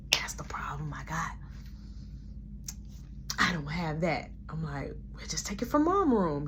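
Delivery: soft hushed whisper; conspiratorial sibling whisper. Retold or imitated. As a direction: whisper it so nobody hears. Secret talk about weed.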